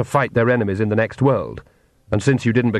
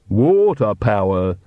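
proud; slower than usual. Acted. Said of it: "said Igor proudly".

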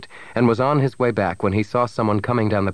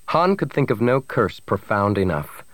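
ordinary delivery

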